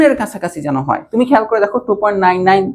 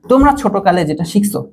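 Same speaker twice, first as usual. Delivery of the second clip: ordinary talk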